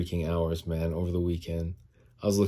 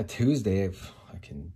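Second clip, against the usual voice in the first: ordinary delivery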